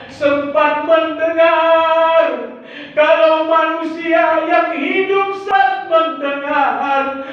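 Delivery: preaching at full force. Acted. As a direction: build the sermon into a slow chant. (khotbah berapi-api, dilagukan)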